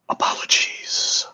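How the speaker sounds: slow, soft whispered apology; death knight voice. Acted. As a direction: whisper it like an undead servant. Death Knight apologises before closing door.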